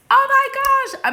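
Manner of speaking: a real thrilled squeal at the viewer's news; excited gasp. Real, not acted. Viewer mentions Peru full moon.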